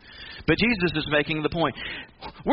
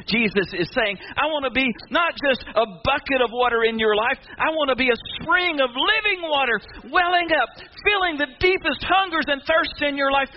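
higher, driving pulpit voice; preaching at full force; 9 semitones higher than usual. Acted. Preacher urges the congregation to get baptized, at full force. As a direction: build up and call the church to the water.

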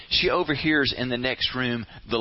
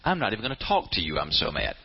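mock sulky anger; 7 semitones lower, faster than usual. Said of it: "when you're at that point".

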